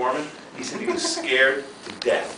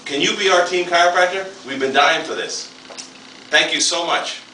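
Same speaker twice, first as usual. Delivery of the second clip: higher, louder gushing thanks; eager welcome; 8 semitones higher, 4 dB louder than usual. Retold or imitated. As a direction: beg them warmly to come in. Dominican team voiced welcoming.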